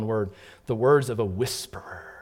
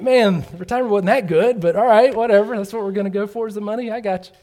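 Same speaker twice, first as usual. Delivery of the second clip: higher, sarcastic resignation; 8 semitones higher, 5 dB louder than usual. Retold or imitated. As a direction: shrug it off with irony. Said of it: "I'm like".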